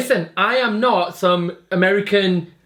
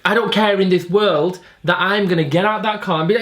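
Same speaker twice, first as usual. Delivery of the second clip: normal talking voice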